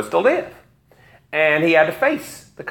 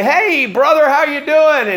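higher, louder hearty hello; glad-handing greeting; 10 semitones higher, 4 dB louder than usual. Retold or imitated. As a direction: play the smooth politician greeting a stranger. Absalom greeting people at gates.

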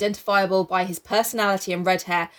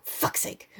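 muttered; 8 dB quieter than usual. Real, not acted. Muttered aside.

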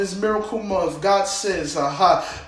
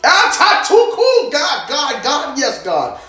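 higher, louder crying out to God; ecstatic cry; 6 semitones higher, 7 dB louder, slower than usual. Real, not acted. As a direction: call out to God in rapture.

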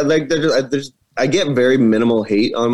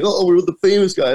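put-on higher, gushing fan girl; excited fan girl; 8 semitones higher than usual. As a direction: mimic the fan squealing.

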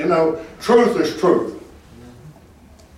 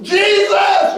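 high-pitched, desperate-sounding cry for help; 3 dB louder than usual. Retold or imitated. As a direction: imitate a sinking man calling out. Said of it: "He cried out".

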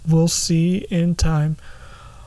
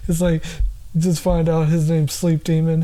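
ordinary delivery

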